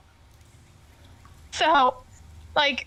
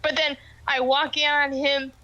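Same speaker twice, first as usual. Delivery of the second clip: ordinary talk, nothing marked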